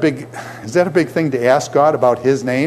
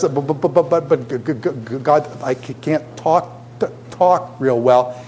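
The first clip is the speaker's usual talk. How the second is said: stuttering Moses. Acted. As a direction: stutter through it like a nervous Moses. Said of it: "Moses was a stutterer".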